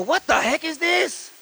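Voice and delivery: high-pitched, drawn-out disbelief; incredulous. Retold or imitated. Preacher retells his appalled reaction.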